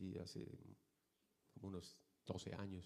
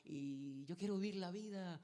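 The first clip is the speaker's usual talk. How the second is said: mocking the girl; 9 semitones higher, slower than usual. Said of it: "muchachita parece de Pénjamo".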